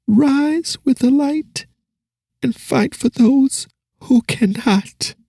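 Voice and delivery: put-on a child speaking with old wisdom, slowly; wise dying girl. Dying girl urging the hero, high and solemn. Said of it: "she spoke like someone twice her age".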